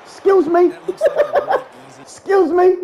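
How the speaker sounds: high sing-song taunt with laughter; mocking taunt. Real, not acted. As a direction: rub it in their face. Mocking the beaten opponent.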